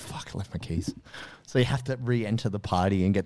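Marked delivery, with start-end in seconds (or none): 0.0-0.9 much softer muttered swear; muttered realisation. Pretend. Partygoer remembering keys, soft mutter. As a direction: mutter it to yourself. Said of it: "and you're like".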